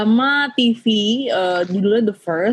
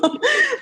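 high embarrassed laugh. Real, not acted. Laughing at her own speech.

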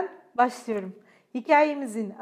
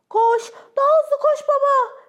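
very high, loud cheering; shouting; 13 semitones higher, 11 dB louder than usual. A narrator's reading. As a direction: cheer dad on at the top of the voice. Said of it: "diye bağırdı Fifi ile Frank".

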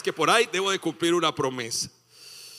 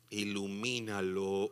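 soft, slow, low whispered wonder; hushed awe; 10 semitones lower, 12 dB quieter, slower than usual. Pretend. Husband gazing at his wife, hushed and awed. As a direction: breathe the word in quiet awe.

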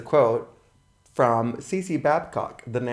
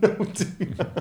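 laughing; 6 semitones higher, slower than usual. Real, not acted. Laughing song pun.

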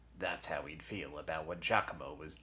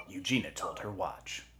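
ordinary delivery

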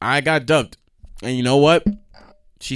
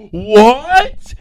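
gasp, then a loud, high "what?"; shocked, rising shout of disbelief; zszokowane niedowierzanie; 9 semitones higher, 9 dB louder than usual. Acted. Outraged at being called broke.

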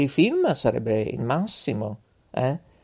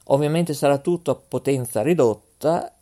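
normal talking voice

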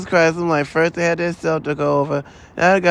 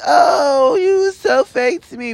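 high, weepy mock sob through the words; mock crying whine; 8 semitones higher, slower than usual. Mocking upset people who feel betrayed, high fake crying whine. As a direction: whine it like a fake wounded friend. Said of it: "they gonna be so mad when they're like".

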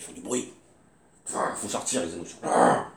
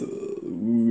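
ordinary delivery

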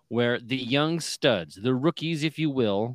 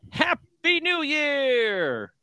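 festive cheer; 13 semitones higher, 3 dB louder, much slower than usual. Real, not acted. Festive greeting, drawn out.

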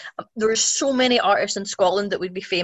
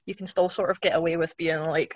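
ordinary talk

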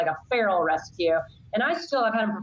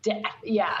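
normal talking voice

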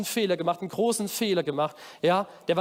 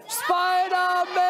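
long, high-pitched shout summoning someone; calling out; 10 semitones higher, much slower than usual. Real, not acted. Leader calling Spider-Man onto the stage with children, calling out. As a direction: stretch the name out in a big high call. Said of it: "rufen wir mal Spider-Man auf die Bühne".

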